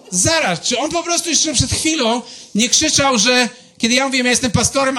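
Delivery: high-pitched, incredulous inner rant; baffled shock. Retold or imitated. Pastor's inner thoughts after the agnostic's reaction, acted bewilderment. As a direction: act out a brain turned to mush, bewildered. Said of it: "A ja mam w głowie po prostu jogurt".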